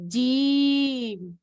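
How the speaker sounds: جیم stretched very long and slow; drawn-out jeem. Shown as a demonstration. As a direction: hold جیم out long for learners. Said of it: "Repeat again". (جیم لمبا کھینچ کر)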